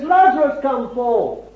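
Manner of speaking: high ringing call, falling at the end; shouted command. Retold or imitated. Jesus calling Lazarus from the dead, shouted. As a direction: call the dead man out loudly. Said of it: "he's shouting when he says".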